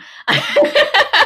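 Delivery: loud high laugh bursting out; real loud laugh, high and free; high burst of laughter. Real, not acted. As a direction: let a big high laugh break out. Shared laugh about perimenopause.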